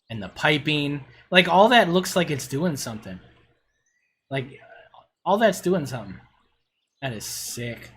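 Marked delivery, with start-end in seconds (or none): none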